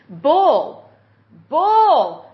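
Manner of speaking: loud, emphatic dismissal, building on the repeat; very firm. Real, not acted. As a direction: punch the word out, harder the second time. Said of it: "I speak very firmly about this".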